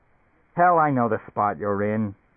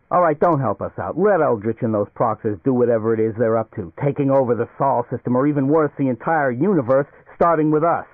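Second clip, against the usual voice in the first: Leo pacing, getting louder as his anger builds; rising, louder anger by the end; agitated outburst; 4 dB louder than usual. Acted. Leo to Barney, agitated, rising to a louder angry rant. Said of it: "Agitated, Leo paced about the living room".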